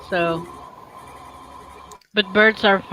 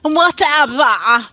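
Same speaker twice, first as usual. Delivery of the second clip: drawn-out, higher mimicking whatever; mock echo; 6 semitones higher, 4 dB louder, much slower than usual. Co-host echoes the other's whatever, mock echo. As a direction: stretch it out slowly, teasingly mimicking.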